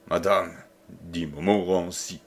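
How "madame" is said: low, falling, controlled address; coldly composed menace; sinister calm. A narrator's reading. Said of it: "avec un calme sinistre".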